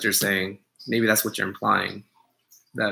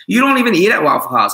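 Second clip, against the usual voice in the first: higher and louder, a mock call-out; mock accusation; 8 semitones higher, 8 dB louder than usual. Teasing a fake Waffle House fan, mock accusing yell. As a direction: catch the fake fan out, loudly. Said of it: "I'll be like".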